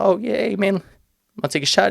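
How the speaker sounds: chirpy dad voice, welcoming; imitating dad. Dad greets son's friend and offers a shot, in a high chirpy voice. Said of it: "my father's like".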